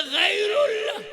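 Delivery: high, loud shouted reply; loud answer. Retold or imitated. The mufti shouts his answer about his son. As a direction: blurt it out loud.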